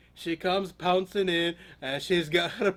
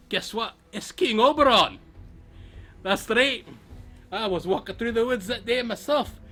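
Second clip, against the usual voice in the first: ordinary delivery, nothing marked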